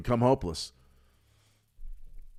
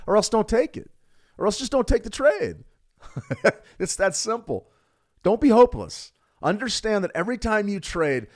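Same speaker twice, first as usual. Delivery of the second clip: fired-up urging; 11 semitones higher, 3 dB louder than usual. Real, not acted. Fired-up exhortation to traders.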